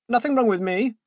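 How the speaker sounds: high, loud indignant cry; cried. Acted. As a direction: protest it, raising your voice high. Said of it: "the man cried".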